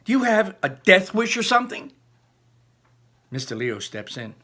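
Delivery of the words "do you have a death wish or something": high, loud, indignant demand; a furious shout at the classmate; furious outburst. Acted. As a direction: snap it out in outrage. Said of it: "I blurt, furious".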